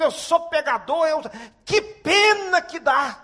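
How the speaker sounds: loud, high, emphatic sermon delivery; forceful pulpit proclamation, stretched stressed words; preaching at full force. Real, not acted. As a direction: drive each point home to the congregation.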